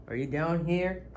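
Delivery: ordinary talk, nothing marked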